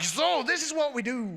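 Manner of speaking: high, loud, laughing through the words; barely holding in laughter; laughing mock explanation. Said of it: "he's like".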